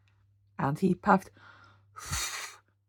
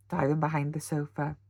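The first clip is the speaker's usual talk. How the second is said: ordinary delivery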